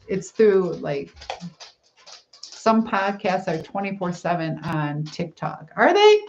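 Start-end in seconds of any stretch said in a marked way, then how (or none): 5.8-6.2 sudden high surprised question; genuinely taken aback. Real, not acted. Surprised by 24/7 podcasts.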